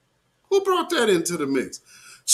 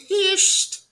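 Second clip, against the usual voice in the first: pissed squealed much higher; stretched emphasis. Real, not acted. Host hyping outrage to viewers, squealing pissed. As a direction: hit pissed high and hard. Host teasing upcoming clip.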